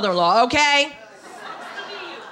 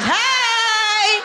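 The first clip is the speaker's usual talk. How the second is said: high, long drawn-out whoop; mock celebratory holler; 11 semitones higher than usual. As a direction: belt it out high and hold it.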